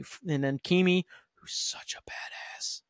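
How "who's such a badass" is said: slow, admiring whisper; hushed awe. Real, not acted. Host marvels at a badass villain, hushed whisper. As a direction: whisper it slowly, savouring the admiration. Awed aside about a character.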